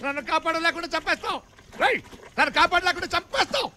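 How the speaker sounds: high, desperate cry; panicked yell. Acted. Man sinking in quicksand yelling at his companion, panicked.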